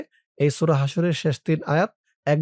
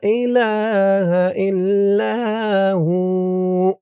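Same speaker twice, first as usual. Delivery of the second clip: very slow chant, higher and louder; tajweed recitation; 4 semitones higher, 4 dB louder, much slower than usual. Shown as a demonstration. Teacher showing where to stretch each sound. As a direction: draw out ইলাহা and ইল্লা, stop on হু. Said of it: "এখান থেকে আমার সাথে বলুন".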